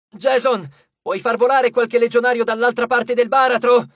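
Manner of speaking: loud, high call across the battle; shouted request. A narrator's reading. Frank to Jason across the fighting, shouted request. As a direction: shout the question over the din. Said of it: "gridò Frank".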